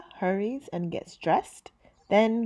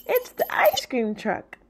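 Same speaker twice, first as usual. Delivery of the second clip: grandma's voice; 5 semitones higher, 4 dB louder than usual. Acted. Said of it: "Abuela Rosa says".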